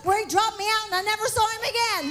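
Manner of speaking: high, excited preaching with shouts of praise; preaching at full force. Real, not acted. Preacher rousing the church to praise, fervent. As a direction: lift the congregation with praise.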